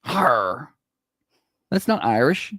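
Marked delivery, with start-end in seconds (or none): 0.0-0.7 short mock pirate "Har"; mock pirate grunt; pirate growl. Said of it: "like a pirate".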